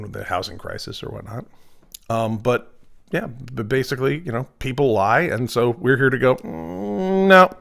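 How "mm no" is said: skeptical. Retold or imitated. Analysts rejecting a lie.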